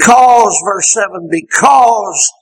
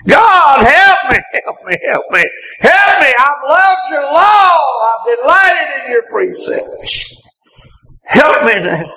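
pleading cry; 7 semitones higher than usual. Acted. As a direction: cry out for help like the psalmist. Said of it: "is that cry".

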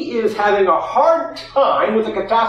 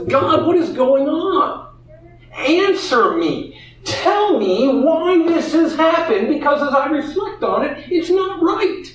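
frustrated plea to God; 7 semitones higher than usual. Retold or imitated. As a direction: call out to God in anguish. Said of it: "in his frustration".

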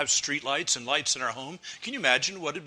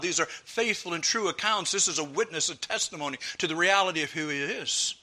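ordinary talk, nothing marked

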